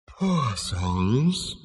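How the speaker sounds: ghostly, drawn-out sigh of pity; airy, mournful otherworldly voice; deep ethereal voice. A narrator's reading. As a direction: intone it like a disembodied spirit. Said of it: "a deep ethereal voice".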